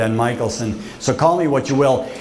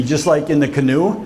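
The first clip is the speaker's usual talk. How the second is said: ordinary delivery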